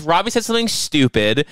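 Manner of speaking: ordinary delivery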